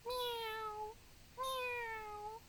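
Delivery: high meowing cat voice. Pretend. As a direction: meow like a little cat. Imitating a cat for kids.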